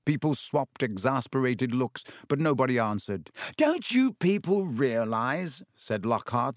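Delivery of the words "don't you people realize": high, drawn-out patronising tone; slow and condescending. A narrator's reading. Lockhart talking down to his class, slow and condescending. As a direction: explain it slowly as if to dimwits. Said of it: "speaking slowly as though they were all a bit dim".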